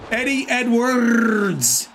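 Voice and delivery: long trilled R in "Edwards"; rolled R's. Shown as a demonstration. Streamer rolls the R in Eddie's last name, exaggerated trill. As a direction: draw out "Edwards" on a showy trill. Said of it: "I can roll the R's in that name".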